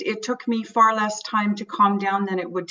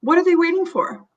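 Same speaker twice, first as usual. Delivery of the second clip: higher impatient demand; mildly acted frustration, pitched up; frustrated; 7 semitones higher than usual. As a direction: demand an answer, fed up. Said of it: "your own sense of panic".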